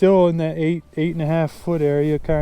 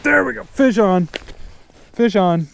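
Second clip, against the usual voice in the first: excited; 5 semitones higher than usual. Real, not acted. Fish hooked on line.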